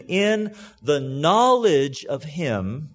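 slow, weighty "knowledge" leaned on hard; stress on knowledge. Shown as a demonstration. Pastor underlining the key word for his church, stressed "knowledge". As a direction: drag out "in the knowledge of him" to underline it. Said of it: "I'm gonna emphasize two words here".

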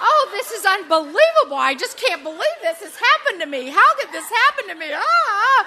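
high, loud mock wailing with laughter; hammed-up panic. Retelling her panic on the drive to the retreat, hammed-up wail. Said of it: "And I'm just".

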